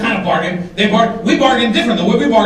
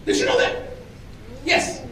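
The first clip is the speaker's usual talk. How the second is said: ordinary talk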